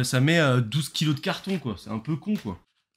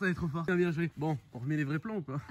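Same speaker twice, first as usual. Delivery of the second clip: ordinary talk, nothing marked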